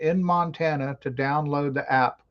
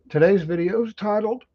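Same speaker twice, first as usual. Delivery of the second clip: ordinary delivery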